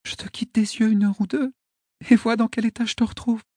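lightly voiced high young girl. A narrator's reading. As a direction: gently scold him as a girl. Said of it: "murmura-t-elle".

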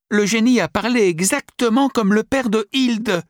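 put-on high, loud astonished girl; excited girl. A narrator's reading. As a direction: blurt it out, amazed. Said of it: "Sophie's excited remark".

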